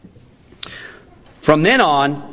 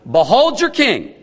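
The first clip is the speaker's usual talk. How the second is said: higher-pitched, mock-grand proclamation; sarcastic; 6 semitones higher than usual. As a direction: present him with dripping sarcasm, voice raised in pitch. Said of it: "his words drip with sarcasm".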